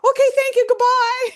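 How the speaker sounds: very high, loud squeal of thanks; comic high-pitched getaway farewell; hurried sign-off. Retold or imitated. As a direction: blurt a mock-panicked goodbye in a high voice. Acts out fleeing the stage.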